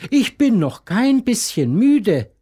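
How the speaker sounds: lightly voiced stubborn little girl; little mermaid protesting. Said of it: "fragt die kleine Nixe empört".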